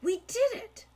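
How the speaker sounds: high, loud burst of triumph; triumphant cry. Acted. Boy cheering his brother after the kill, triumphant. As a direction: shout it out, we won.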